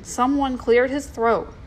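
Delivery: plain reading voice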